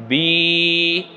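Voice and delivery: বি with a long ee vowel; long bee. Shown as a demonstration. Teacher modelling for viewers, বি with long ee. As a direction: stretch the ee in বি. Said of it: "উচ্চারণ কেমন হবে?".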